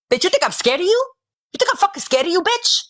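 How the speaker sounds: high angry jeer, bitch flung far up; defiant, show you're not scared at all; furious taunt. Real, not acted. To the rival who threatened her, furious jeer.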